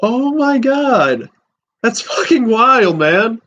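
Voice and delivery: ordinary delivery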